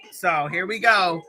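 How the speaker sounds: usual speaking voice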